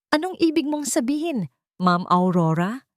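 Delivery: nervous, forcing himself to be polite; fearful politeness. A narrator's reading. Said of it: "Pilit niyang ginagalang ang tawag". (takot na magalang na tanong, mataas at mabagal)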